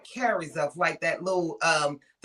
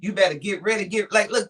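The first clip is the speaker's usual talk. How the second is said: normal talking voice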